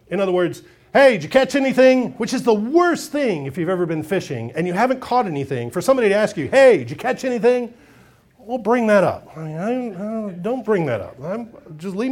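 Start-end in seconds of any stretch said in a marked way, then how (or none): 0.9-2.1 a bright call across the water, pitched up and louder. Acted. Calling out to the fishermen. 6.5-7.7 a second loud, high call of the same question. Acted. Repeated taunting question.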